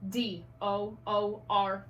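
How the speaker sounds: "D O O R" spelled slowly, letter by letter; spelling out letters. Shown as a demonstration. Teacher modelling spelling to first graders. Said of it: "This is how I want you doing it".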